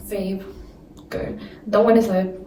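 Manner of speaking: ordinary talk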